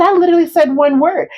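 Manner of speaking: high, exasperated protest; wife pushing back at her husband, high and indignant. Retold or imitated. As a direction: insist you barely spoke.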